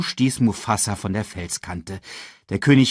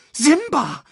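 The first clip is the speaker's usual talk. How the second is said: very high squawked call; bird's squawk; 3 dB louder than usual. A narrator's reading. Sasu flies to Simba through the dust, squawking. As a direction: squawk the name like a bird. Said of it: "krächzte Sasu".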